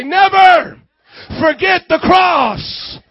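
loud, high, held-out call; rallying shout. Real, not acted. Preacher tells church to say it to neighbor, rallying shout. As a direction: shout it out for the congregation to echo.

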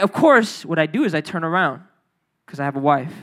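usual speaking voice

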